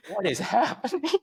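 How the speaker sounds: high-pitched mock bewilderment; flustered passenger. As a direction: imitate a baffled guest, thrown completely. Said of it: "flustered and stuff".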